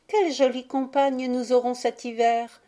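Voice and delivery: a hint of a delighted child; lightly voiced little girl. A narrator's reading. Violette to her little brother about their snow playmate, lightly childlike.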